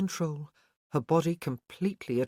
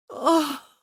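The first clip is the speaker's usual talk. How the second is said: sharp high cry; high pleasured cry; 11 semitones higher, 8 dB louder than usual. A narrator's reading. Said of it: "she groaned".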